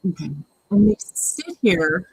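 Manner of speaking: ordinary delivery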